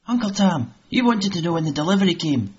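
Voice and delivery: put-on eager boy. Jamie telling his uncle about the haggis, eager boy voice. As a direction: pipe up like an excited boy.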